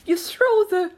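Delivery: high, loud creature voice; high excited turtle; Mock Turtle. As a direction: build up loudly, pitched high. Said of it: "the Mock Turtle went on".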